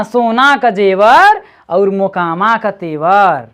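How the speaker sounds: slow Bhojpuri proverb recital; reciting a saying. Shown as a demonstration. Teacher repeating the Bhojpuri proverb, reciting slowly. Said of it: "Bhojpuri में पढ़".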